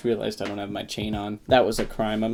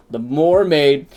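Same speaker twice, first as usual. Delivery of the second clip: MERMAID said as "more-maid", loud and raised; booming proclamation; 5 semitones higher, 11 dB louder, slower than usual. Pretend. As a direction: belt out "mermaid" as "more-maid", like announcing a title. Booms out the pun nickname.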